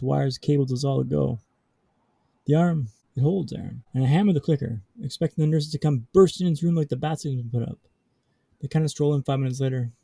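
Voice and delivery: ordinary delivery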